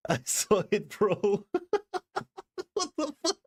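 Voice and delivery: squealing high laugh breaking into words; real disbelieving laugh. Real, not acted. Streamer to a generous donor, real high squealing laugh. Overwhelmed by a big donation.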